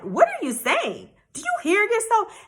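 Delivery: retold disbelief, voice leaping upward; acted incredulity. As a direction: question him as if he makes no sense. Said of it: "I'm looking at him like".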